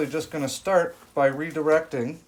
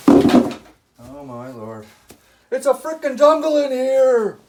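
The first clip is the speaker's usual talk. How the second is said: loud, high burst of amazement; surprised exclamation; 12 semitones higher, 8 dB louder, slower than usual. Real, not acted. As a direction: blurt it out, overwhelmed. Overwhelmed by crowded greenhouse plants.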